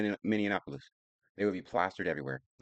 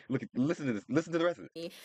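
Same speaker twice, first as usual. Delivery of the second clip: laughing; 10 semitones higher than usual. Real, not acted. Laughing while showing the clip.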